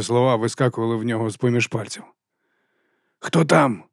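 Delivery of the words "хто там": mild call, slightly raised. A narrator's reading. Walker asks who is on the radio, calling out. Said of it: "гукнув він".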